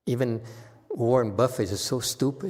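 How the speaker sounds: normal talking voice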